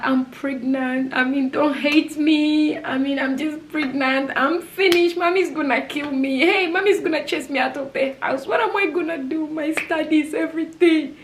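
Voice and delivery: high, re-enacted wailing plea; panicked pleading. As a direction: spill it out high-pitched, as if falling apart. Re-enacts panicked confession to her sister.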